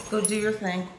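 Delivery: ordinary delivery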